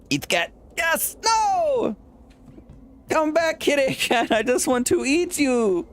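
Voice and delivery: very high squeaky voice begging the cat; squeaky dinosaur. Acted. Dinosaur chases the cat, very high and squeaky. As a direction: play a tiny dino hungry for kitty.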